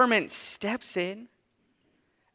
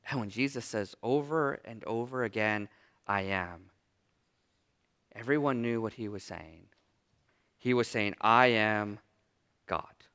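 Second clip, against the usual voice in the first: much lower and softer, reverent; hushed preaching; 10 semitones lower, 9 dB quieter than usual. Acted. Preacher telling the church what Jesus meant, hushed and awed. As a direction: drop to a low, awed hush.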